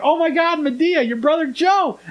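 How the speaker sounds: high-pitched imitated panic; playfully acted frantic warning; mock alarm. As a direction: cry out in hammed-up shock, voice way up. Acted alarm at brother dragged away.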